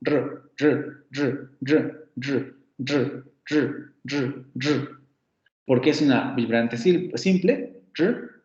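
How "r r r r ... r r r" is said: repeated "r" as a voiced retroflex trill, tongue curled back; short rolled "r" sounds in a row; trilled r demo. Shown as a demonstration. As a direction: roll "r" over and over, tongue curled behind the ridge. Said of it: "lo más cercano a este fonema sería algo así como r".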